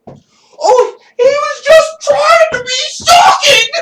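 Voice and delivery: high, loud, shrill mock outrage; mocking meme critic. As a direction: shriek it like an offended critic. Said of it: "Did you hear that meme?".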